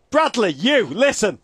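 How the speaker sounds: high, commanding locker-room speech; single out players like a coach; mock team talk. Coach calling out players by name, hammed-up rallying. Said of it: "Here's what I'd say if I were Jurgen".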